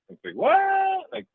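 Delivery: incredulous. Real, not acted. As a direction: stretch it out in disbelief. Disbelief at quitting.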